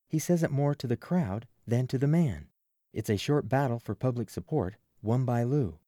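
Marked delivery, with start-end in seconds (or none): none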